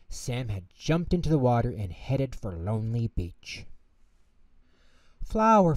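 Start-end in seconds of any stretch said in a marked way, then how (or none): 5.2-5.7 high, loud urgent call. Acted. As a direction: call to her from the water. Penguin calls urgently to flower.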